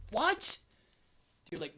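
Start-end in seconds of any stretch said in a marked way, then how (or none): 0.1-0.6 high loud shocked what; stunned outburst at the border guard. Retold or imitated. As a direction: fling it up in alarm. Told he can't leave the country.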